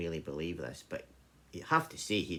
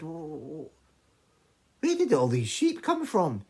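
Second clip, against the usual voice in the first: amazed stammer; 8 semitones higher, 8 dB louder, slower than usual. Acted. Brother marvels at the sheep.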